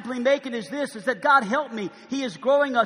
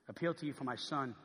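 ordinary talk

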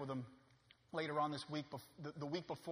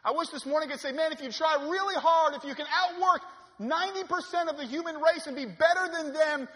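loud, high, impassioned sermon cry; preaching at full force; 12 semitones higher, 15 dB louder than usual. Acted. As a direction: preach it out, much louder and higher.

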